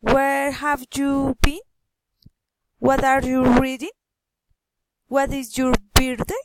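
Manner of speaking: BEEN, READING and BIRTHDAY swing up at the end of each question; friendly rising intonation. Shown as a demonstration. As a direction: ask with polite interest, lifting sharply on BEEN, READING, BIRTHDAY. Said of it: "rising intonation".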